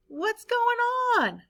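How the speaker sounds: very high, slow puzzled question; David asking as the ball is stolen, very high and slow; surprised. Acted. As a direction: sound caught off guard, drawing it out.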